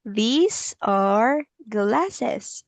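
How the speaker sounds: very slow, stretched words with rising tones; teacher's drawn-out repeat-after-me delivery; slow model sentence. Shown as a demonstration. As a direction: stretch each word for a learner.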